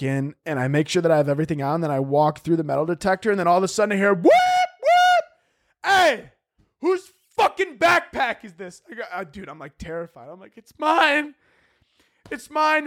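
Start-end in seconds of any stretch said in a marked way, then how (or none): 4.1-8.8 loud high alarm shout; O'Hare guard yelling across the checkpoint. Retold or imitated. Angry security calling out. 10.6-12.8 high, small frightened voice; scared confession. Retold or imitated. Terrified traveler confessing.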